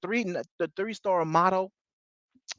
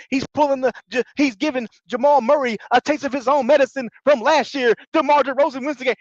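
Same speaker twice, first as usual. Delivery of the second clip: hyped fake game call, higher and rising; mock play-by-play; 8 semitones higher than usual. Imagined clutch game play-by-play.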